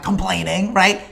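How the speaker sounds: ordinary delivery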